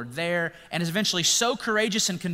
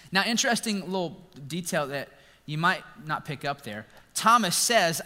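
normal talking voice

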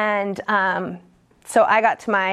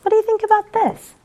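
imitating the sponsor; 12 semitones higher than usual. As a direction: coax like a sponsor offering ideas, high and bright. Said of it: "the things that she says".

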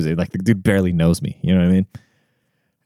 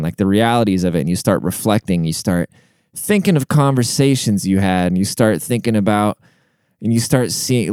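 usual speaking voice

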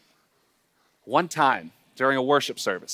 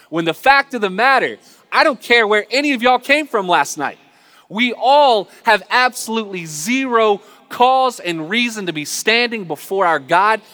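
louder, higher, fervent preaching; full-force preaching; 8 semitones higher, 6 dB louder than usual. Acted. Preacher telling the congregation nobody deserves grace, loud and fervent. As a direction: drive it home to the congregation.

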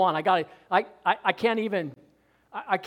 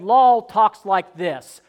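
law talks like this; 8 dB louder, much slower than usual. Retold or imitated. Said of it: "Paul said".

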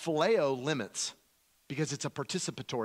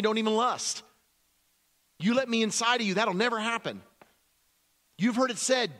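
loud, high, punchy sermon delivery; impassioned preaching; 6 semitones higher, 4 dB louder than usual. Acted. Jesus correcting his listeners, forceful preaching. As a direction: drive each command home, pitched up.

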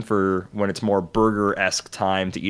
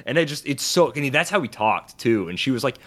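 ordinary talk, nothing marked

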